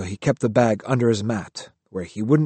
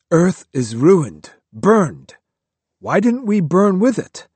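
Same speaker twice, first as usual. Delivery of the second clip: louder, bitter outburst; angry; 6 semitones higher, 4 dB louder than usual. A narrator's reading. Kyo angrily arguing with Riku in the dark. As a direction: let old anger break through. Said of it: "Kyo was getting angry again".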